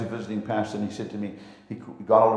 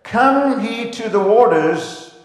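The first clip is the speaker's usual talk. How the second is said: scripture reading; 6 semitones higher, slower than usual. Acted.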